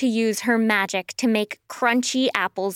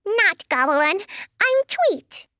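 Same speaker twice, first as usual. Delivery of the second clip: high, chirpy bird voice; little firebird; 8 semitones higher than usual. Acted. Firebird corrects her friend.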